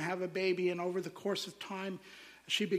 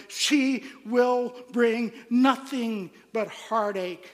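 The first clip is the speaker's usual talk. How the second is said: higher, louder, mounting insistence; doctor's grim prognosis; 3 semitones higher, 8 dB louder than usual. Retold or imitated. Doctor warns mother about her baby, building to loud, emphatic condemnation. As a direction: build from measured speech to forceful pronouncement.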